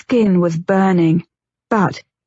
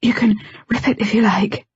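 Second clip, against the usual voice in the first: whisper; 2 semitones higher than usual. A narrator's reading. Wife inviting her husband to tear her dress, in a whisper. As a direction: whisper it close to his ear.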